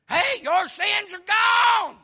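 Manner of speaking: loud, high, slow shout; joyful shout. Acted. Jesus tells the woman her sins are gone, shouting joyfully. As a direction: proclaim it like the best news ever. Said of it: "and he said".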